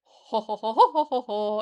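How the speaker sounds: loud booming ho-ho laugh drill; ho ho laugh exercise. Shown as a demonstration. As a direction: practise a hearty ho ho.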